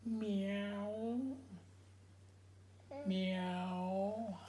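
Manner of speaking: lightly voiced high meow; cat meow. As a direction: meow like a kitty. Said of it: "what does a kitty cat say?".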